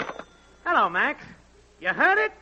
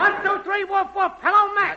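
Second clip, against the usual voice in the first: high-pitched mic-check holler; woofing and yelling; 8 semitones higher, 4 dB louder than usual. Acted. Technician testing the network for Max, woofing and yelling. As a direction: yell out a test call to Max. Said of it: "woofing and yelling, "Hello, Max".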